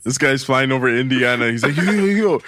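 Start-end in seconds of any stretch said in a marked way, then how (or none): 1.8-2.4 high-pitched, playful pilot call-out; joking pilot. Acted. Pilot flying over Indiana hailing a girl, playful call-out.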